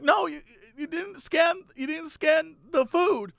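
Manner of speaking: put-on high, soft, slow lady with a slight German accent. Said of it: "sort of has a German accent".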